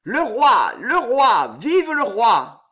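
loud, high cheering for the king; crowd cheering. Acted. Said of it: "des cris, des vivates".

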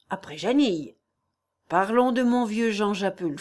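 ordinary delivery

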